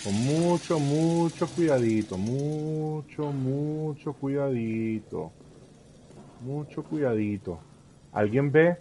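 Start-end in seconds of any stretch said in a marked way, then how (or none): 0.0-7.6 slow, softer, drawn-out warning; slow creepy voice. Acted. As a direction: stretch it out, sneaking.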